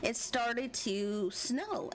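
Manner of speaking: ordinary talk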